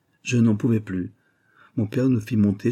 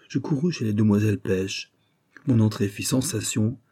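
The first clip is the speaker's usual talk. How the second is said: ordinary delivery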